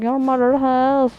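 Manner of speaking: high, slow drawn-out retort; mock taunt. Gamer answering a baffled kid, mock taunt. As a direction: stretch the comeback out up high.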